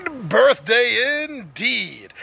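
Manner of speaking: very slow, drawn-out mock pomp; mock-grand proclamation. Host echoes his nerd birthday to viewers, mock-grand. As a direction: stretch it out like a grand announcement.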